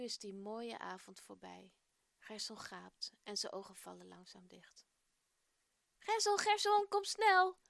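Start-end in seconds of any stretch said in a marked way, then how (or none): 6.0-7.6 high, loud call from afar; calling loudly. A narrator's reading. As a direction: shout his name to hurry him. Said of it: "Wie roept daar zo hard?". (luid roepend, veel hoger)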